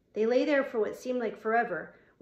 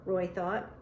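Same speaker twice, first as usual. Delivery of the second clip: plain reading voice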